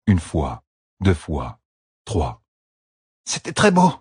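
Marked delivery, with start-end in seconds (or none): 3.2-3.9 high, worn-out praise; increasingly hoarse. A narrator's reading. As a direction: rasp it out, voice failing. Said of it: "d'une voix de plus en plus rauque". (compliment d'une voix rauque)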